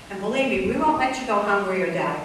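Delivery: ordinary talk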